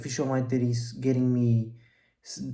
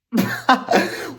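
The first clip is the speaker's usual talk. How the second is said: a high, breathless burst of disbelieving laughter; incredulous laugh; 11 semitones higher, 9 dB louder than usual. Real, not acted. Laughing in disbelief at a classmate's fifteen thousand followers. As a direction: laugh in shock at the unfair numbers.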